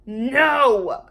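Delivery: loud, high no; yelled. A narrator's reading. Lulu refusing the Brontosaurus, yelling. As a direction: yell it out in protest. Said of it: "yelled Lulu".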